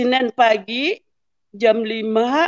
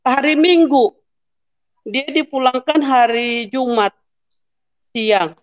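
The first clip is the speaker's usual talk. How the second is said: ordinary delivery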